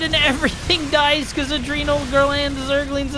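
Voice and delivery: high, excited laughing rant; laughing excitedly. Real, not acted. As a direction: gush with laughter. Laughs while hyping Zerglings.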